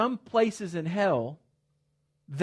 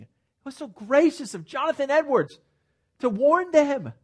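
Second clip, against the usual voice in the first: higher and louder, pressing the point; ringing pulpit emphasis; full-force preaching; 10 semitones higher, 7 dB louder than usual. Acted. Preaching climax.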